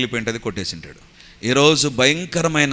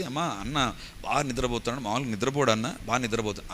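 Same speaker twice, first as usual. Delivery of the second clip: much softer, hushed phone report; hushed worried call; 8 dB quieter than usual. Retold or imitated. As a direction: quietly tell her he won't wake. Worried sister phones quietly.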